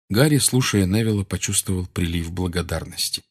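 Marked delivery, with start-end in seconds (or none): none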